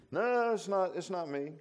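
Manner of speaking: high, hasty denial; nervous denial. Acted. Peter denying again, high and hasty. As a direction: shrug it off fast.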